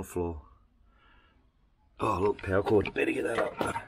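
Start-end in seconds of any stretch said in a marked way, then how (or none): none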